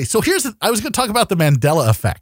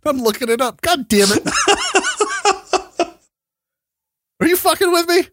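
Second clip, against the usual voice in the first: laughing. Real, not acted. Laughing disbelief at teasing.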